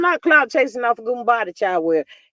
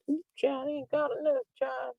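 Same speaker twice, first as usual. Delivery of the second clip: murmured under the breath, much softer; a confidential gossip mutter, nearly to herself; hushed aside; 4 semitones higher, 12 dB quieter than usual. Real, not acted.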